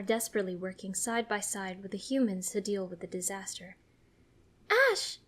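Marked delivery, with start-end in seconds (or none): 4.7-5.2 very high, sharp call; yelling. A narrator's reading. Serena getting Ash's attention, yelling. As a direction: call the name out urgently. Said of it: "Serena yells".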